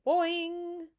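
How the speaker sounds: high, loud twanging cry; one high, ringing 'boing'; playful yell. A narrator's reading. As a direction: yell it like a stretched spring. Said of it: "and yell".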